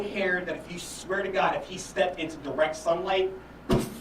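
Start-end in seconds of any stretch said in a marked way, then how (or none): none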